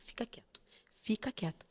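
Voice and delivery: very soft, secretive command; whispered hushing. Retold or imitated. Mother quieting her son in public, whispered. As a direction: shush him under your breath.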